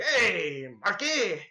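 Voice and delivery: creepy clown calling out; drawn-out sing-out of a lurking clown; Pennywise. Retold or imitated. Clown calls to him from the sewer, creepy call. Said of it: "I'm Pennywise the Dancing Clown".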